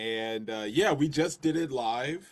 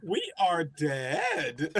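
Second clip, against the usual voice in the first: slow words broken by laughter; laughing; 6 semitones higher, much slower than usual. Real, not acted. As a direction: say it through a laugh. Reacting to the story, laughing.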